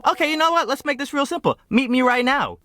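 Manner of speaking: high-pitched mock ultimatum; exasperated, high retort; fed-up challenge. Calls her bluff by text.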